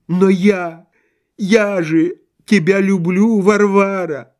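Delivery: high, drawn-out declaration of love; lovelorn pleading. A narrator's reading. Husband pleads his love.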